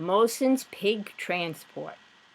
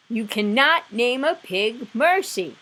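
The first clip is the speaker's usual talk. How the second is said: mild shout; 5 semitones higher, 6 dB louder than usual. Acted. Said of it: "shouted Eugenia".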